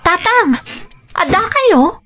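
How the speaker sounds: high startled calls breaking into a cry. Acted. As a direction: gasp out father's name in shock. Finds father back home. (nakigtot nga ikkis)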